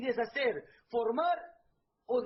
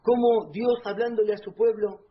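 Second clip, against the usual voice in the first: loud, fervent preacher exhortation; predicación fervorosa a todo volumen; preaching at full force; 3 semitones lower, 7 dB louder than usual. Acted. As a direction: call the congregation to look again, loudly.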